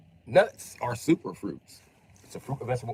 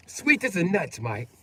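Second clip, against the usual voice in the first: higher, light mock British lilt; mock British phrase; 7 semitones higher than usual. Quoting British people, a light mock British accent. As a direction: toss it off like a Londoner. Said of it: "I hear British people say that".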